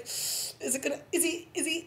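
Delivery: high, hushed, stammered questions; nervous suspense. Retold or imitated. Viewer on her couch fearing a bullet, nervous suspense. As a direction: re-enact fretting under your breath.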